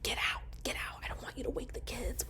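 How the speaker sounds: breathless unvoiced whisper; urgent whisper. Boyfriend whispering her out of the house. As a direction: hush them out fast so no one wakes. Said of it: "He's like".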